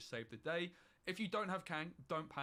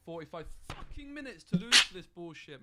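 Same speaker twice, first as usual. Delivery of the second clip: louder, higher furious complaint; furious rant; 5 semitones higher, 11 dB louder than usual. Real, not acted. After forty-five minutes of waiting, a furious loud rant. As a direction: vent the fury loud and high. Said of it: "I'm so mad right now".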